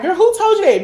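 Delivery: high, offended demand; indignant friend. Retold or imitated. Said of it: "be like".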